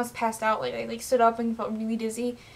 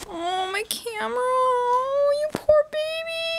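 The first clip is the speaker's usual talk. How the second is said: high, slow cooing; tender pity; 12 semitones higher, much slower than usual. Real, not acted. Consoling the fallen camera.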